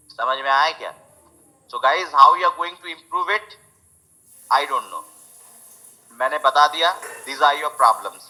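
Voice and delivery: usual speaking voice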